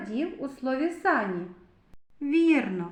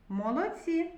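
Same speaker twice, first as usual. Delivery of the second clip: ordinary delivery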